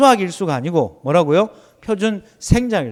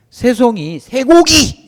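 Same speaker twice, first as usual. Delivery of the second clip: playful shout; 4 semitones higher, 6 dB louder, slower than usual. Acted. Calls out wrong answer playfully.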